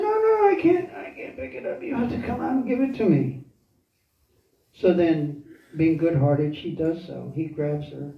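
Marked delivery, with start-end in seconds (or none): 0.0-3.4 high, strained put-on voice, hurried at the end; acted high-pitched insistence; begging sage. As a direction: plead in a high voice to lure someone closer. Disguised demon begging Sita to come out.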